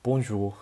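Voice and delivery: "bonjour" with a nasal French on; French hello. Shown as a demonstration. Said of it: "how to say hello in French".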